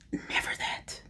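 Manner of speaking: breathy hushed whisper. Real, not acted. Telling viewers never to give that power, whispered. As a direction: say it like a secret.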